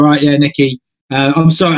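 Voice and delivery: normal talking voice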